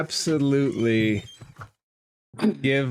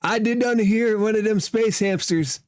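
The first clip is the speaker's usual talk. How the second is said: high, raised folksy cook voice; clownish cook; 10 semitones higher, 3 dB louder than usual. Acted. Cook reacts to news of a hamster, high clownish exclamation.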